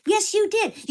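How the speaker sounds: high-pitched, emphatic contradiction; retold insistent retort. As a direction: fire back in an acted argument. Retold back-and-forth quarrel.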